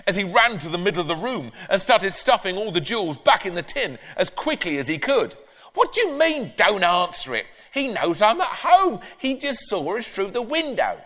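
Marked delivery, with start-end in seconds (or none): 5.7-11.0 granny voice. Acted. Granny scolding Ben.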